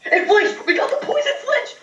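very high, laughing shriek of delight; overjoyed squealing. Real, not acted. Player getting a poison flinch, squealing with laughter.

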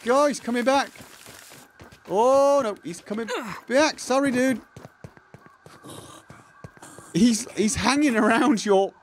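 flustered panic as danger closes in; breathless fright. Real, not acted. Spider chasing him in game.

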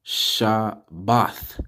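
"Shabbath" slowly as sha, bath; syllables joined. Shown as a demonstration. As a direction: say "Shabbath" in two beats, ending in th. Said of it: "Tha together".

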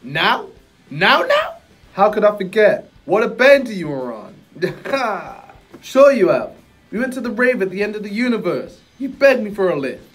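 laid-back street-wise genie; cool guru voice. Acted. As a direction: play it smooth and relaxed, a genie with swagger. Acted guru lines.

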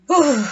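high exhale of relief; breathy whew. Real, not acted. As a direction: blow out a winded whew. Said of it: "I'm just out of breath".

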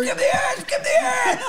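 high frantic yell; screaming. Real, not acted. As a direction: shriek it again and again. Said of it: "how loud you're screaming".

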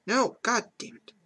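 high sharp cry of frustration; frustrated outburst. Real, not acted. Player yelling at the failing makeup artist. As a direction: let the annoyance explode.

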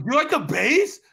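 retold club shout. Man retelling club chat-up to his ex, mock shouting. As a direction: imitate calling over a loud club. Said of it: "But it was so loud".

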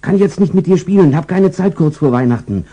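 family too busy for the pet; brushing off the dog. Acted. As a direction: shoo the dog away, no time. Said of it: "wenn alle sagen".

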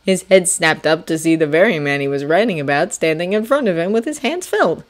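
plain reading voice

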